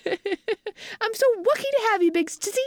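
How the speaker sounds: Franklin, adoring. Shown as a demonstration. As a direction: coo sweetly like a little brother to his sister. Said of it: "and I use that voice".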